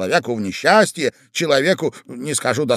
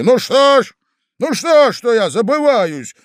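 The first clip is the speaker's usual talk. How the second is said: louder, higher, seething through clenched teeth; furious through teeth; 7 semitones higher, 3 dB louder than usual. Acted. Young man turns on the gentleman in fury, teeth clenched. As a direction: spit it out in rage. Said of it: "проговорил молодой человек, стиснув зубы".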